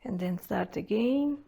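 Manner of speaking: calm, paced breathing instructions; guided breathwork. Acted. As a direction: coach the breath step by step, unhurried. Leads a breathing exercise.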